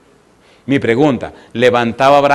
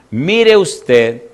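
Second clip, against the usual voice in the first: slow pointed prompt; much slower than usual. Acted. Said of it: "preacher slows to point".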